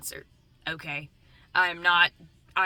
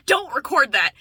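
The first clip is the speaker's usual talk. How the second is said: loud, high mock outburst; imitated flustered command, raised and forceful; Fred; 7 semitones higher, 10 dB louder than usual. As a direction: snap it out as an indignant movie character. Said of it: "And then he's like".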